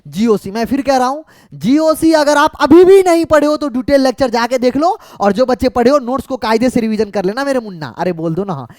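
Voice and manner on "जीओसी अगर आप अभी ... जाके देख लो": loud, higher-pitched insistent telling-off; emphatic urging. Real, not acted. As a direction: raise the voice and press the warning on students. Repeats warning to students insistently. (ज़ोर देकर ऊँची आवाज़ में हिदायत)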